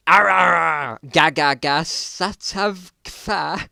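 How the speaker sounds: loud, strangled pained roaring gibberish. A narrator's reading. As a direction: bellow like you're being torn apart. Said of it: "exclamation of pain".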